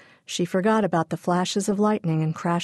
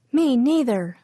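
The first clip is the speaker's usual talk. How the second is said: put-on higher small-child voice; little sister; 6 semitones higher than usual. A narrator's reading. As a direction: chime in like a toddler. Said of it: "Pidge said".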